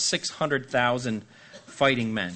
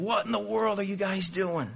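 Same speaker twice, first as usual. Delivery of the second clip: taunting voice; 7 semitones higher than usual. Retold or imitated.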